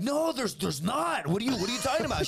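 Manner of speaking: high, flustered protest; acted-out innocent bluster; panicked denial. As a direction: stammer out a guilty denial. He denies it to girlfriend.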